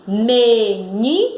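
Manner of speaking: "Menina" slow and stretched, ni rising; stressed syllable demo. Shown as a demonstration. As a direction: say "Menina" as me, NI, pause, na. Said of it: "houve um impulso mais forte aí no ni".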